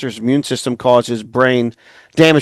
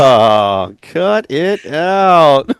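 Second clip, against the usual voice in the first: comic catchphrase; much slower than usual. Pretend. Teasing his co-hosts after a joke, slow hammed-up catchphrase. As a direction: stretch it out like a sitcom dad.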